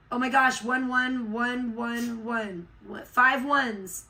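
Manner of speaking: sudden loud, amazed outburst; startled excitement. Real, not acted. Streamer sees five ones appear and shouts in surprise. Spots repeated ones on screen.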